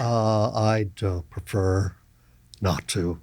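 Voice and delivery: low, soft, slowed mock reluctance; reluctant refusal. Actor declining to run lines, reluctant and low.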